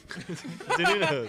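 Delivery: high, slow laughing repeat. Real, not acted. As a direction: giggle the word out. Laughing at a typo.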